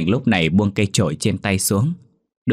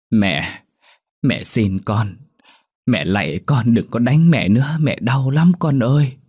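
rising, louder desperate begging; pleading; 4 semitones higher, 4 dB louder than usual. Acted. Old mother begging her daughter to stop, rising desperate plea. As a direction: beg your child to stop hitting you. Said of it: "liền van xin".

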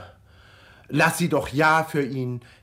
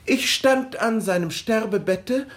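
ordinary talk, nothing marked

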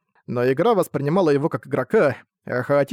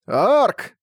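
higher, louder name call; 7 semitones higher, 3 dB louder than usual. Acted. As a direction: call him across the field. Brad calls out.

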